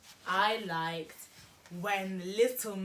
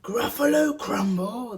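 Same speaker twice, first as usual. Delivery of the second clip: loud, higher, rough monster cry; horrified Gruffalo; 6 semitones higher, 8 dB louder than usual. Acted. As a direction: exclaim as a monster about to be eaten. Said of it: "the Gruffalo said".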